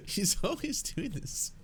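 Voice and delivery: real giggle breaking through the words; laughing. Real, not acted. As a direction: laugh out high while talking. Laughing at the host's habit.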